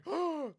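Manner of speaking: high, loud imitated gasp; mock horrified gasp. Imitating Mon Mothma in the meme, loud hammed-up gasp. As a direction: suck in air in exaggerated shock. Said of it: "She's just like".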